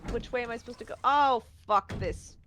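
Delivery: ordinary delivery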